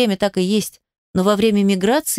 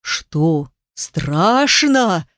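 half-howled tease; much slower than usual. Acted. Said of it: "Уже почти провыла она".

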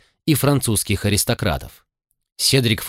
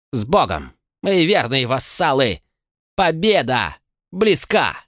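booming warrior king; 7 semitones higher than usual. A narrator's reading. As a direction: shout orders to the army. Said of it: "Голос короля стал громче".

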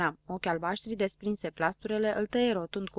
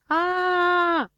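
high, loud crying howl; girl's howl; 10 semitones higher, 6 dB louder than usual. A narrator's reading. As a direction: wail it out like a frightened little girl. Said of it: "urlă prichinduța". (urlet ascuțit de fetiță)

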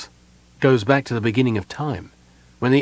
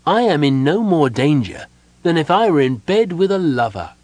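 raised, louder, carefree boast; exhilarated; 6 semitones higher, 5 dB louder than usual. A narrator's reading. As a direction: brush off danger, elated. Ecstatic prince dismisses the danger.

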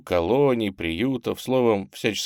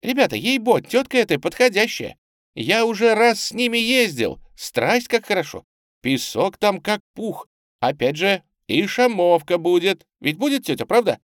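put-on high, excited little boy; animated shout; 12 semitones higher, 5 dB louder than usual. A narrator's reading. Small boy persuading the other urchins, lively high shouting. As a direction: call out eagerly to your mates. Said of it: "оживлённо крикнул".

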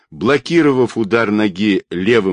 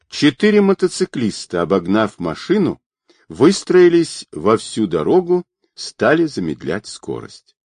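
plain reading voice

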